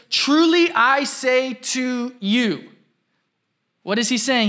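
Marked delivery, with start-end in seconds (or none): 0.1-2.7 slow, solemn declaration of "Truly"; quoting Jesus. Retold or imitated. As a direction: weigh each word like scripture. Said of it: "He says".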